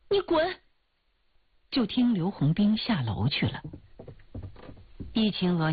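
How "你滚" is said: 高而短促地喝斥; 拔尖嗓音赶人走; 怒喝赶人. Acted. Heroine drives suitor away. (女主角恼怒地高声轰走追求者)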